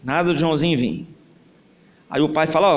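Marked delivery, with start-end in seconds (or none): none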